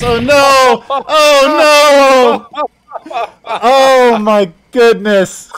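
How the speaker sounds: very loud, high outcry with laughter; shocked shout. Real, not acted. Caster reacting to a disastrous play, shocked shouting. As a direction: wail it out in real disbelief.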